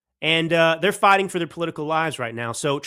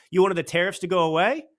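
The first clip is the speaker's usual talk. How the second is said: ordinary talk, nothing marked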